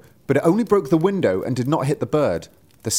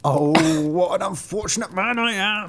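lamenting driver; 7 semitones higher than usual. A narrator's reading. Driver bemoaning his dead horses, high lament. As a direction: cry out as the luckless driver. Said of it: "Then the driver cried".

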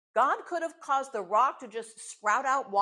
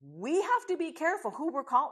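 usual speaking voice

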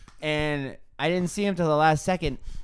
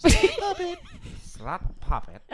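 British accent; 10 semitones higher than usual. Shown as a demonstration. On a friend's request, attempted British accent. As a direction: try it out in a put-on British voice. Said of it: "That was actually a perfect British accent".

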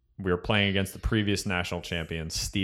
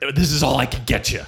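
raised, hammed-up coach voice; mock battle speech; 6 semitones higher, 5 dB louder than usual. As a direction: play the commander apologizing to troops, loudly. Mock coach rallying kids for battle.

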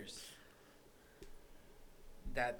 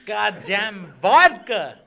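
loud, slow exasperated yell; frustrated shout; 3 semitones higher, 13 dB louder, slower than usual. Real, not acted. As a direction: blurt it out at the hiccups.

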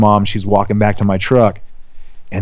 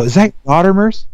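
put-on kid's voice; 8 semitones higher, faster than usual. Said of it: "one of the kids all".

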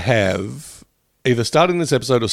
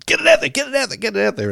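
frantic; 12 semitones higher, faster than usual. Retold or imitated. Frantic deadline brainstorm voice.

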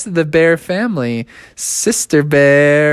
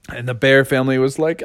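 plain reading voice

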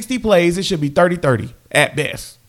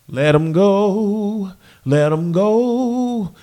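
slow, drawn-out let him go; 2 semitones higher, much slower than usual. Real, not acted. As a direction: stretch each word out, dismissive. Drawn-out dismissal of kicker.

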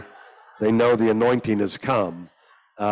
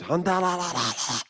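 imitating tongues; 9 semitones higher than usual. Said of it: "started praying in tongues".

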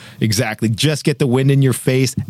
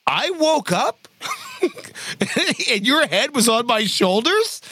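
higher, slowed voice for the friend; buddy explaining; 8 semitones higher than usual. Retold or imitated. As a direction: act out the shaken buddy's account. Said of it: "He's like".

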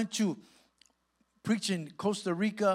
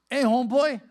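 loud, higher-pitched hail; calling out; 8 semitones higher, 11 dB louder than usual. Retold or imitated. Inmate spots the preacher crossing the prison yard, calling out. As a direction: call out like an inmate across the yard.

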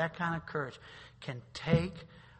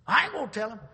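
higher, louder stubborn refusal; defiant kid; 6 semitones higher, 7 dB louder, faster than usual. Retold or imitated. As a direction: snap back like a stubborn teenager.

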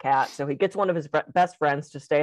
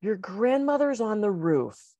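slow, careful, halting delivery; slow punchline; 4 semitones higher, 3 dB quieter, slower than usual. Acted. Friend on the phone, slow halting delivery. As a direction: break it gently.

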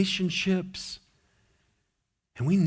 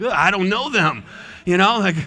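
put-on scared toddler; 5 semitones higher, 6 dB louder than usual. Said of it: "you could see the terror".

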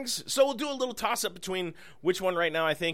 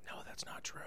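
whispering; 19 dB quieter, faster than usual. Real, not acted. Joking aside retracted.

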